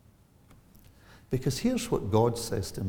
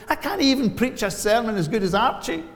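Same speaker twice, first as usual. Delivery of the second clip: higher, louder Moses, half-incredulous; protesting Moses; 13 semitones higher, 9 dB louder than usual. Retold or imitated. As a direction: play Moses balking at God's call, raised up. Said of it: "says Moses".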